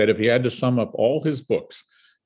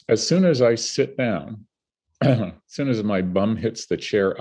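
ordinary delivery

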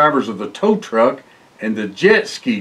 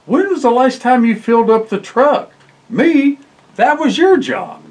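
twins bickering; 8 semitones higher than usual. Acted. Twins bickering over gas.

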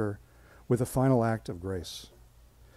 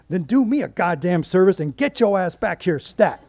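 high, loud frantic order; panicked demand; 7 semitones higher, 8 dB louder than usual. Retold or imitated. Nancy demanding her coworker come back, loud and high. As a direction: shout it down the line.

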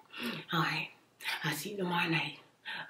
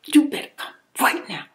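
retold angry demand; 6 semitones higher, 9 dB louder than usual. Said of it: "I said".

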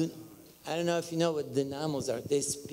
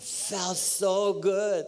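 slow, raised, drawn-out delight; elated relish; 5 semitones higher, 3 dB louder, much slower than usual. Real, not acted. Preacher recalling the spinning, slow elated relish. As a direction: stretch it out in rising rapture.

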